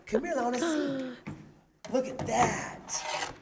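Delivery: high, slow cooing. Real, not acted. Dad cooing high and slow at his son's drawing. Talking to toddler.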